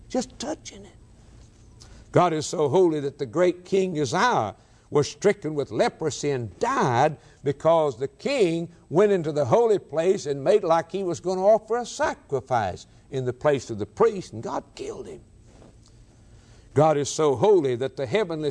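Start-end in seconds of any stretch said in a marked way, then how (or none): 0.1-1.0 high, soft, breathless disbelief; high hushed amazement; awed squeak. Real, not acted. Preacher marvels at Uzzah's fate. 14.3-15.2 high, soft, stunned; a hushed awe-struck blow. Real, not acted. Preacher stresses judgement.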